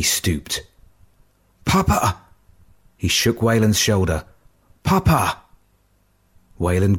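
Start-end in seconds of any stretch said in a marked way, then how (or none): 1.7-2.2 high, desperate call to a fallen father; frantic cry. A narrator's reading. Son finds wounded father. 4.8-5.4 high, desperate call to a fallen father; frantic cry. A narrator's reading. Son shakes wounded father.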